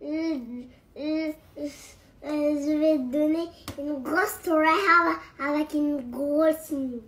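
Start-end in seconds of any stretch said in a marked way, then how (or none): 2.2-7.0 halting English-accented French. Real, not acted. Child trying mum's phrase, hesitant English accent. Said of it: "il a un accent anglais du coup".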